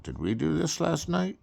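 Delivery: high, startled question; hungover surprise. Retold or imitated. Waking up hungover and asking if they did this. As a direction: squeak it out in hungover panic. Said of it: "We're like".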